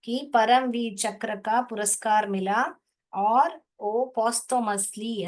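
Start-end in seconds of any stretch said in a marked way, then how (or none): 3.1-5.3 slow reading. Shown as a demonstration. Teacher showing the class how to read posthumously. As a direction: posthumously read slowly, syllable by syllable. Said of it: "ऐसा पढ़ने का इसको".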